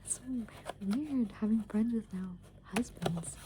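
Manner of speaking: ordinary talk, nothing marked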